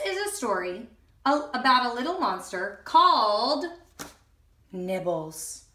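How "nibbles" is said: plain reading voice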